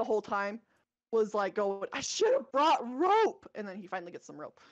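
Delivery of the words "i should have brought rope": louder, higher, drawn-out mock outcry; exclaimed quote. Quoting Sam lamenting the missing rope, exclaimed. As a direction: cry it out slowly, high and loud, imitating him.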